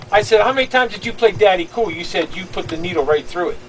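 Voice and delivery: normal talking voice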